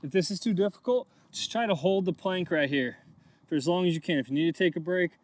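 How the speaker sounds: ordinary delivery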